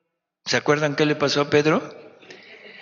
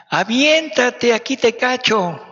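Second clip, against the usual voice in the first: calling out encouragement; 10 semitones higher, 4 dB louder than usual. Acted. Voice below urging the one on the board to jump. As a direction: coax the jumper loudly from the pool.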